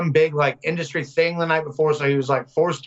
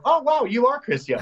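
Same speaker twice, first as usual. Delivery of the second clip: high, star-struck teasing; exaggerated amazed quip; mock-impressed; 11 semitones higher than usual. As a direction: play up the awe, pitched way up. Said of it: "And I go".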